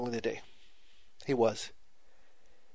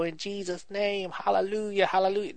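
showy spiritual praying; 5 semitones higher than usual. Acted. Preacher showing how he didn't pray over coworker, showy spiritual praying. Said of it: "I didn't get all spiritual".

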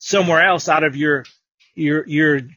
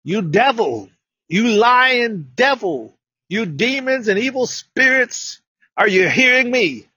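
rebuking the devil; 6 semitones higher, slower than usual. Retold or imitated. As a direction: drive out the lying devil. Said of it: "Then I said".

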